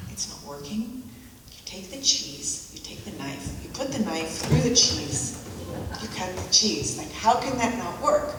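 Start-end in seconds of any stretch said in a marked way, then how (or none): none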